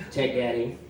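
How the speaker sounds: usual speaking voice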